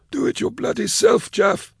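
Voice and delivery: very high, strained sneer. A narrator's reading. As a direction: sneer it in a squeezed, high-pitched voice. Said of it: "sneered the overweight man".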